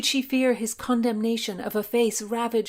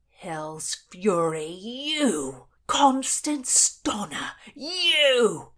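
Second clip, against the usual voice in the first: bellow; 2 semitones higher, 3 dB louder, much slower than usual. Acted. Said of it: "bellowed Blackjack".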